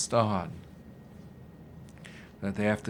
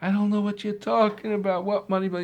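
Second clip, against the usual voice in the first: pleading denial; 8 semitones higher, faster than usual. Acted. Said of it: "He's crying".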